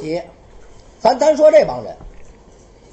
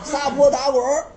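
normal talking voice

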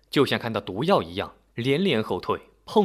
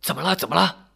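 urgent, higher-pitched questions; worried old man, voice raised in pitch; anxious repeated asking; 6 semitones higher, faster than usual. A narrator's reading. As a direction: fire it off twice in concern. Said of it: "老头连声地问".

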